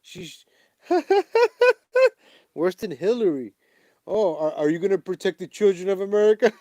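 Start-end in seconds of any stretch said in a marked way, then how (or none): 0.9-2.1 high, loud fake cackle; mock cackle. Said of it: "her laugh". 4.1-6.5 high, loud, laughing fake politician voice; mocking Kamala. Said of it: "her fakeness".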